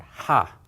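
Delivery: "ha" with a hard, breath-heavy h; forceful pharyngeal h on "ha"; emphatic H. Shown as a demonstration. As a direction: blast air through a tightened throat on "ha". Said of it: "this is what we call an emphatic".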